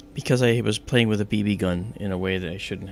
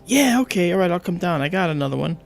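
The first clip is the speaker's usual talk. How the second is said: high-pitched, eager acted answer; phone call reply; 8 semitones higher, 4 dB louder than usual. Boy answers his friend's call, high eager reply. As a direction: play the keen friend agreeing on the phone. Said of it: "the phone call starts like this".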